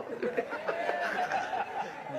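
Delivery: high-pitched drawn-out laugh. Real, not acted. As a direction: let out a long high giggle. Laughs after retelling photo request.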